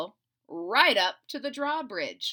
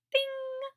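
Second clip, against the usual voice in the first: ding. Acted. Idea sound effect.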